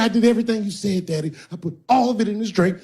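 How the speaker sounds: nervous bottom bitch. Acted. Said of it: "She said".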